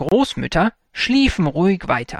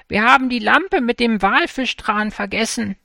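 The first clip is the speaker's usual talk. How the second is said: calling out; 7 semitones higher than usual. A narrator's reading. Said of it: "rief die andere".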